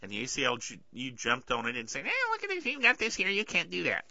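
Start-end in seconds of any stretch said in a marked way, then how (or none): 2.1-4.0 much higher, whiny mocking voice; hammed-up nagging busybody; mock ACLU complainer. Podcaster mocking ACLU objecting to display, high mocking whine.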